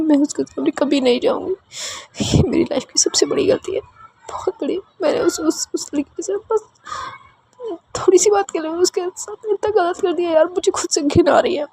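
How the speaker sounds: very high, half-whispered through crying; sobbing in disgust at herself. A narrator's reading. Chiku sobbing to Anandi about her mistake. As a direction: choke the words out through tears. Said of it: "वो बहुत रो रही होती है".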